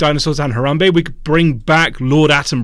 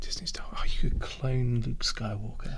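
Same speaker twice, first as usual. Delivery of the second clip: whispering; 3 semitones lower, 11 dB quieter than usual. Real, not acted. Hushed aside about cloning.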